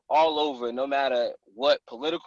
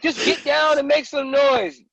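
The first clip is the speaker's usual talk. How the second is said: higher, louder, slower voiced buddy; buddy's advice; 13 semitones higher, 6 dB louder than usual. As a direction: lightly play the friend telling him what to do. Bubba's advice retold in a story.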